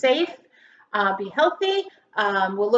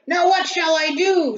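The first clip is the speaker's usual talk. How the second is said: a mild, hammed-up dismayed cry, a touch louder; 7 semitones higher, 6 dB louder than usual. Farmer McNugget frets as the children cry, mildly dismayed. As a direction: fret aloud like a startled old farmer. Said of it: "cried McNugget".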